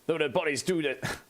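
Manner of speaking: a hint of a grave battle brother; Space Marine voice. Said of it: "I've got to try and see if I get the words right".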